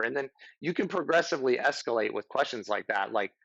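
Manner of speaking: ordinary talk, nothing marked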